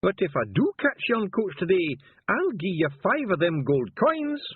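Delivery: high, loud Scottish voice, slowed right down; a gruff little Feegle in disguise barking orders; high Scots wee man. Acted. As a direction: Nac Mac Feegle voice, slow and commanding. Feegle passenger voice.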